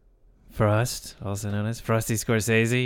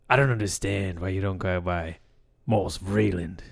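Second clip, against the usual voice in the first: low, cool friend's voice; imitating a great voice; 3 semitones lower, 4 dB quieter than usual. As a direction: say it smooth, low and unhurried. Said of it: "great voice. He had a way of saying".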